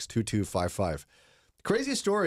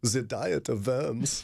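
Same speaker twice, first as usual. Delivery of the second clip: mock German accent, slow and drawn out; a hammy German accent on the phrase; much slower than usual. Morning host hamming a German accent for co-hosts, slow. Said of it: "any excuse for my German accent".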